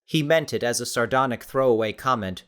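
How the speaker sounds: ordinary delivery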